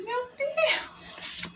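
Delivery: delighted shout of gratitude; overjoyed exclamation. Real, not acted. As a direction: burst out in joyful disbelief. Overjoyed at receiving help.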